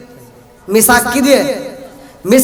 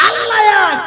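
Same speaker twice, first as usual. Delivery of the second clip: high ringing cry; fervent cry; 11 semitones higher than usual. Acted. Preacher crying out God's oneness to listeners, high. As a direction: cry it out to the crowd. Preacher cries out God is one.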